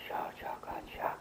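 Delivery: soft whispery "sha" pulse; imitated rhythmic pulse. Shown as a demonstration. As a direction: mimic the pulse in hushed, unpitched beats. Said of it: "it's almost like a".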